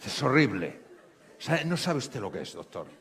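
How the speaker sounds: mock outrage building up; an upset patient venting about her boss; getting worked up. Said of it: "se iba viniendo arriba la mujer".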